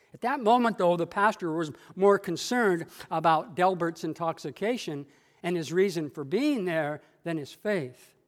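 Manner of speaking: ordinary delivery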